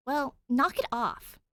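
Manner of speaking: high, loud, slow angry order; hot demand. Acted. Danny telling the cop to stop, hot and loud. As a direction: bark it at him hotly. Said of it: "Danny demands hotly".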